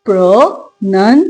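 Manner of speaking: Pronunciation in separate beats, pro-NUN-see-AY-shun; syllable stress demo. Shown as a demonstration. Teacher demonstrating where stress falls, syllable by syllable. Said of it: "मैं आपको बोल के दिखाती हूँ".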